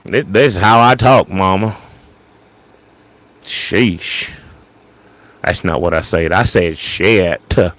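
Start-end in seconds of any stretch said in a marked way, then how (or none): 0.0-1.8 put-on higher, protesting Southern kid accent; Mississippi accent. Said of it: "I'd start talking like that". 6.8-7.6 "shit" drawn out with a hard, separate T. Shown as a demonstration. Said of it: "I pronounced the T hard".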